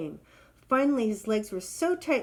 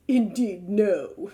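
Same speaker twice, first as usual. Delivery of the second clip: louder, haughty refusal; scoffing; 6 dB louder, much slower than usual. Acted. Proud giraffe refusing the hornbill, louder scoff. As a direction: sniff it down like a snob. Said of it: "scoffed the proud elephant".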